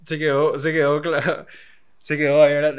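laughing loudly through the words; can't hold back the laugh. Real, not acted. Laughing while telling the story. (riendo fuerte mientras cuenta)

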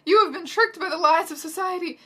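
high, loud mock shriek; hammed-up righteous yelling; knowing shriek. A narrator's reading. Harry accusing Voldemort, knowing mock shriek. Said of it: "Harry shrieked knowingly".

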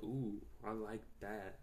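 low, soft, drawn-out flirty purr; mock come-on, quietly savored; flirty imitation. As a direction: imitate a flirty woman, slow and hushed. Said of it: "She's being flirty and shit".

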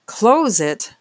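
a sharp, incredulous outburst; bursting out. A narrator's reading. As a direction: blurt it out in disbelief. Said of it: "Victoria burst out".

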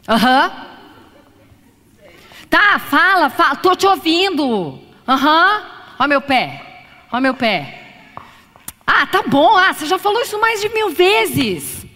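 wife mocking her husband as he talks; ironic brush-off. Shown as a demonstration. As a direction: dismiss him while pretending to listen. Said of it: "você quer ver um sinal de ironia?".

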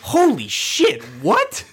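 high, slow, stretched-out gasp of disbelief; stunned disbelief. Retold or imitated. Watching the movie, amazed that the wrestling star appears, stunned. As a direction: act floored by the twist. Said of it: "I'm like".

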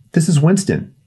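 put-on high, hesitant kid voice; a nervous boy calling down a well; shy boy Winston. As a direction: Winston introducing himself awkwardly.

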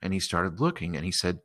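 usual speaking voice

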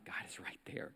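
voiceless, very soft inner thought; hushed whisper. Retold or imitated. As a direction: whisper it as Moses' private realization. Moses imagining God beside him.

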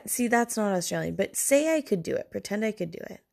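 ordinary talk

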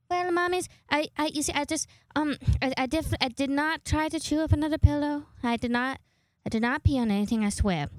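put-on talking-dog voice, starting high; Tutu. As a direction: voice a dog stammering out excuses. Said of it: "doing Tutu's voice".

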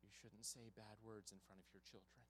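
a hint of a child's earnest rebuke; little daughter's voice. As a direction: gently scold daddy like a little girl. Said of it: "She says".

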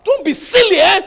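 loud, high, angry commands; a father yelling at his misbehaving child; stern father shouting. Retold or imitated. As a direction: bellow like a strict father at a naughty child. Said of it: "the voice of a man say".

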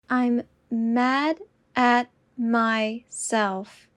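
slow and deliberate. Shown as a demonstration. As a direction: say it slowly and clearly. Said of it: "repetition drill, slowed take".

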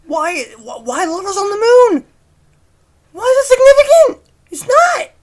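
shrieked falsetto rant, very loud. Real, not acted. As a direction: exasperation shooting up into falsetto. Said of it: "I'm going falsetto".

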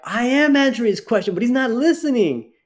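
high, loud, exasperated protest; mad retort. Retold or imitated. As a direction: insist over him, fed up. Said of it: "And he's so mad".

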